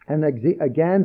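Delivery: ordinary talk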